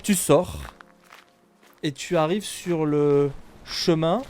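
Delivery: ordinary delivery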